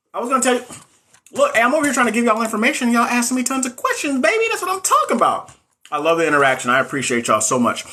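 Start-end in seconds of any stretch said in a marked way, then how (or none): 0.1-5.5 higher, fast, gushing excitement; riding the buzz of a busy chat; hyped up. Real, not acted. Streamer hyped at viewers flooding in questions, excited.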